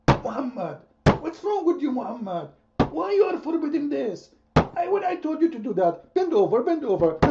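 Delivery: high, mocking voice of God scolding; mock Allah voice. Allah scolding Muhammad in a high mocking voice.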